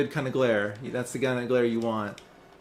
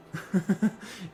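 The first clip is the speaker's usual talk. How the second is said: high-pitched chuckle; real high laugh; 7 semitones higher than usual. Real, not acted. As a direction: giggle at your own boast. Laughing at apparently being cutest.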